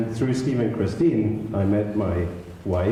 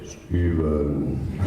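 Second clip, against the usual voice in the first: low, flat deadpan name; Steve's greeting; 6 semitones lower than usual. Retold or imitated. Friend answering the phone, a low deadpan greeting. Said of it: "he would say".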